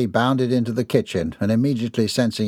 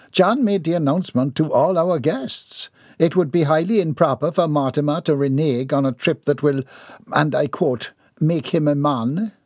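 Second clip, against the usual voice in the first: higher, prim Southern lady; ladylike voice; 5 semitones higher than usual. Acted. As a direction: speak as a proper, composed matron.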